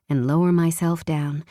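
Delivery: plain reading voice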